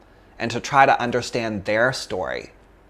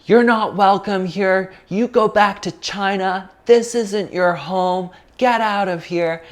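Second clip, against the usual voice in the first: higher, slow, jeering taunts; 8 semitones higher than usual. Retold or imitated. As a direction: sneer the lines at the girl from another voice. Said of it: "went to town on her and were like".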